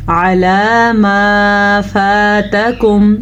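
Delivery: slow, drawn-out tajweed chant; slow melodic Quran recitation; Quranic recitation. Shown as a demonstration. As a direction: recite with long, measured vowels. Recites verse with madd.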